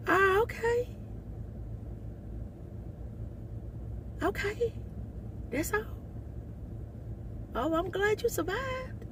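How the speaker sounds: high, soft, slow sarcastic calm; mock polite disbelief. To the stabbed man, high soft sarcastic calm. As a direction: humour him with fake sweetness, high. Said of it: "I'm like".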